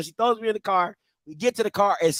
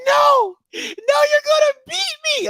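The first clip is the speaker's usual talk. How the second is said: retold screaming refusal; slower than usual. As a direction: scream back at mom in mock panic. Retold child refuses to stop.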